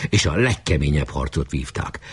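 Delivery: ordinary delivery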